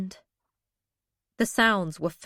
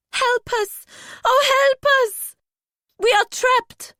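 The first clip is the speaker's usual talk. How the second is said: much higher, much louder scream for help; desperate shrill scream; 8 dB louder than usual. Acted. Alaria screaming for rescue, desperate, high and loud. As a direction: scream to be heard through stone. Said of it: "stopped shrieking for lack of breath".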